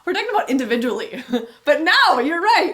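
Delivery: talking through laughter, high and quick; amused protest breaking into laughs; laughing. Real, not acted. Amused at partner's interjection.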